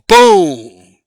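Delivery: deep, loud booming "Boom"; booming mock impact. Host landing his point with a deep boom. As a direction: drop it like a bass hit. Punchline sound effect word.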